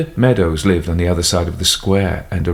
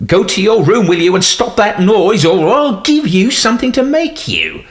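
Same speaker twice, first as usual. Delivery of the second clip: louder and much higher, barking at the maid; harsh shouted order; 5 dB louder than usual. A narrator's reading. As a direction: snap her out of the room. Butler orders Emily away.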